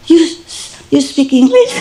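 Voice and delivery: high, halting amazed question; surprised. Retold or imitated. Owner asking dog about English, surprised. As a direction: stammer it in laughing disbelief. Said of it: "I was so surprised".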